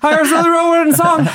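high-pitched crowd holler; mock heckler shout. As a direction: yell it out as a joking audience member. Joins in imitating shouted audience plugs.

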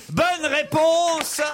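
high, drawn-out confirmation; triumphant announcement. Real, not acted. Quiz host confirming the missing word to the panel, triumphant announcement. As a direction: proclaim the correct answer, stretching it out.

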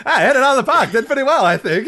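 high, loud, laughing ballplayer impression; old ballplayer voice. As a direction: chuckle through a bashful slugger. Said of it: "Al Kaline was like".

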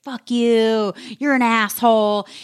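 loud, high mock swearing at a partner; angry swearing. Retelling past fights, shouting insults at partner. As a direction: spit the insults out, heated. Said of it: "like, like".

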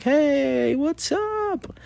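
high, friendly hey what's up; bright greeting. Retold or imitated. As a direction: greet a little kid cheerfully.

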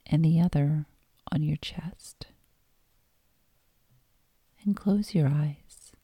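calm meditation voice with long pauses; guided meditation. Acted. Host guiding listeners lying down into yoga nidra, guided meditation. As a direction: guide them gently inward, leaving space between phrases.

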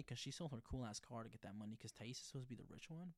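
usual speaking voice

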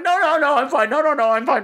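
high, flustered retold protest; flustered refusal. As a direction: wave someone off in a mock fluster. Retold panicked brushing off of help.

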